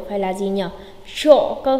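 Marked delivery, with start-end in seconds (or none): 1.1-1.5 "Sộ" said as sho, hushing sh; correct "sho" sound. Shown as a demonstration. Teacher correcting learners, "Sộ" pronounced sho with sh. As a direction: say "Sộ" with sh at the start. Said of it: "không phải là sộ".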